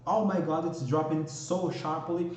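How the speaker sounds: ordinary talk, nothing marked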